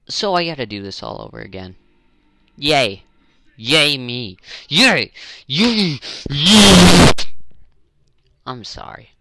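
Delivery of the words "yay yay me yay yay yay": sarcastic yay, louder with each one until the last is yelled; mock cheering. After losing the whole recording, he yells a sarcastic yay at himself. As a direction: cheer bitterly, pretending to be thrilled.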